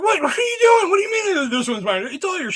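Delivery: high, loud mock exasperation; exasperated yell. Dad yelling it's all yours at the daughter. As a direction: throw up your hands and yell it.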